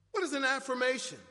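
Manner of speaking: ordinary talk, nothing marked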